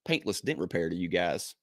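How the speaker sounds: big, punchy podcast host opening; announcer intro. Acted. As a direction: hype up the co-host like a ring announcer, with dramatic pauses. Hypes the show and co-host.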